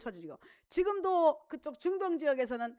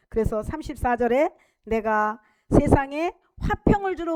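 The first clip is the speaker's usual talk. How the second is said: loud preaching read; forceful scripture reading; 10 dB louder than usual. Acted. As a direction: proclaim each verse loudly. Reads scripture at full force.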